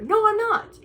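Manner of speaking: high and loud protest; exasperated no. Real, not acted. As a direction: push back hard, fed up. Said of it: "denying to an imagined guy".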